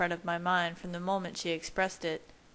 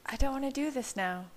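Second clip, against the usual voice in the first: higher voice, half-hearted protest; weak objection in a raised pitch; unconvincing refusal; 7 semitones higher than usual. A narrator's reading. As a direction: refuse without really meaning it. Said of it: "her tone unconvincing".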